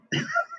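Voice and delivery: short high-pitched, soft laugh; real laugh. Real, not acted. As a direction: laugh lightly at your own story. Laughs at his own story.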